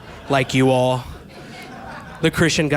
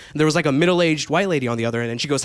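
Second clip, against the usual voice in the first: ordinary talk, nothing marked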